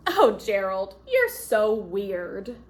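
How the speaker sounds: mocking. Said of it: "the animals all sneered".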